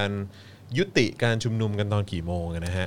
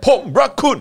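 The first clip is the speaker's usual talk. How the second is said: very high, loud German-style shout; hammed-up Teutonic bark in Thai; mock forceful German; 10 dB louder than usual. Shown as a demonstration. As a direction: declare love as if picking a fight in German. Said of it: "นี่จะรักหรือจะลบเนี่ย".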